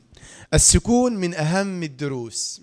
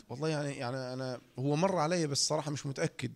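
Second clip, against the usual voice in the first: imitating a hesitant, much softer answer; unsure; 2 semitones lower, 11 dB quieter than usual. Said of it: "هل أنت متأكد؟". (متردد وغير متأكد، بصوت خافت)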